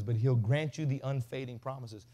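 usual speaking voice

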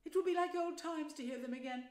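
mildly pleased, reminiscent storytelling voice; touch of pride. A narrator's reading. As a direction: let a little pride into the mole's recollection. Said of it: "with a touch of pride in his manner".

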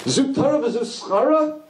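high, slow, rising "schara?" as a protest; outraged question. Shown as a demonstration. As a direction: demand how this can be the reward. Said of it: "That's not how to read it".

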